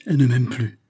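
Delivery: falling cry of dismay; distraught exclamation. A narrator's reading. Philippe hears of Marthe's decision, distraught exclamation. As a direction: exclaim it, shaken, with some force. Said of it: "s'écria Philippe, bouleversé".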